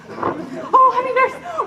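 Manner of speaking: high, laughing greeting; laughing with joy. Acted. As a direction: laugh out the words in delight. Juliet sees the nurse arrive.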